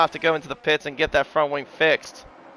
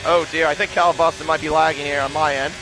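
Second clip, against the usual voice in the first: ordinary talk, nothing marked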